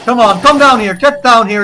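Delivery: high, loud mock battle shout; goading the enemy. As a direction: yell up at the planes to come down. Shouting at enemy planes in game.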